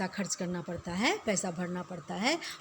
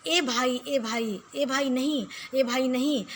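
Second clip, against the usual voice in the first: ordinary talk, nothing marked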